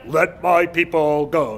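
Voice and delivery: slow, loud movie-prophet proclamation; hammed-up epic Moses line; Charlton Heston. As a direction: declaim it grandly, drawing out each word. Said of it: "You know, Charlton Heston there".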